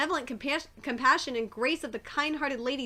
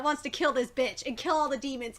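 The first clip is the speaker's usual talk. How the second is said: ordinary delivery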